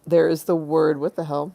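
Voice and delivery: normal talking voice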